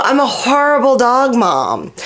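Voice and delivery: ordinary talk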